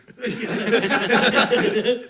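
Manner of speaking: loud burst of laughter. Real, not acted. Laughing at B team joke.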